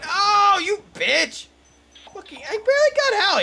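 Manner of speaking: high, loud furious yelling; real outrage. Real, not acted. Gamer yelling at ghosts who ganged up on him, furious. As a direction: yell at the ghosts that jumped him.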